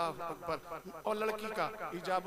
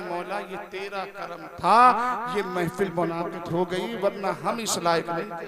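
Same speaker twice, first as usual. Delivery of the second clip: humble prayer; 4 semitones lower, 12 dB louder than usual. Pretend. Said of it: "अर्ज करें".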